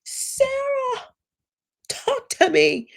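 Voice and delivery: high, drawn-out mock wail; hammed-up desperate plea to Sarah; mock pleading. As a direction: imitate him whining for attention, high-pitched. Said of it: "he just types".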